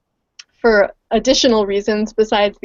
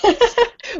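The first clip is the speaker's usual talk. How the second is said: a bright, much higher laugh; 12 semitones higher than usual. Real, not acted. Guest laughs before sharing her story, high laugh. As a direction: laugh as you begin.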